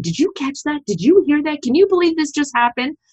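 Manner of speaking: high, incredulous questions; disbelief. Retold or imitated. Recalling asking fellow audience members, high and incredulous. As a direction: mimic asking around the room in amazement.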